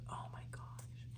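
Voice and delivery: breathless, unvoiced whisper of awe; soft gasped whisper, almost inaudible at the end; awed whisper. Real, not acted. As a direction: hush it out as if overwhelmed by the card. Awed whisper over a tarot card.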